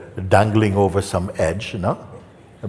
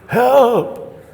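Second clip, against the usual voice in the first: high, loud storyteller's cry; mock shout for help; 7 dB louder than usual. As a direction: yell out for rescue, hammed up. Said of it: "He's shouting".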